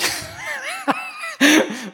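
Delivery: a high, bursting laugh of scorn; defiant laugh. Acted. Preacher laughing in the face of judgment, high defiant laugh. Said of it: "We laugh in its face".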